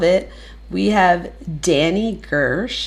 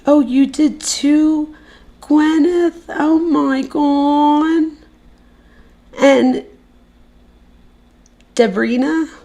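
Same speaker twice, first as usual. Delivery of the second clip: high, drawn-out delight; thrilled surprise; 10 semitones higher, 4 dB louder, slower than usual. Real, not acted. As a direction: stretch each word in real amazement. Thrilled at a new subscriber.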